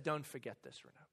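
very soft, low murmur; hushed, low retold reminder; gentle whisper. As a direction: imitate a quiet voice speaking to the heart. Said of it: "just whispers to my heart".

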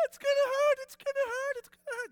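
high, loud, tearful wail; weeping wail. Retold or imitated. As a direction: sob the words out in panic. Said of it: "Hadley's weeping".

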